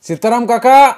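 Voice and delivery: high, full-voiced call by name; loud shout. A narrator's reading. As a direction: shout for someone hard of hearing. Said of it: "रवी जोरात ओरडला".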